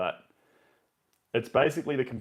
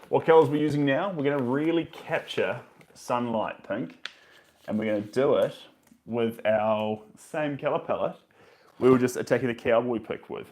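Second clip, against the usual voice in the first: normal talking voice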